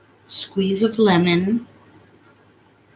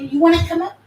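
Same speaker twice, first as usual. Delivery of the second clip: higher, sing-song coaxing; 9 semitones higher, 3 dB louder, faster than usual. Real, not acted. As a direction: coax the dog up sweetly. Coaxing the dog up.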